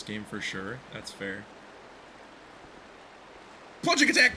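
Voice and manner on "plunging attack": high loud attack call; battle cry. Acted. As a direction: shout the move name like an anime hero. Calling out game move.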